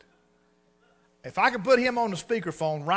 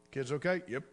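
normal talking voice

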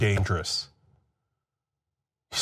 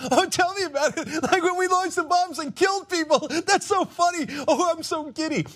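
high, gushing mockery of a fawning news anchor; mock giddy anchor; 13 semitones higher than usual. Host mocking the news anchor's fawning interview, high and giddy.

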